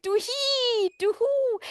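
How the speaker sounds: high, soft hooting call; owl hoot. Acted.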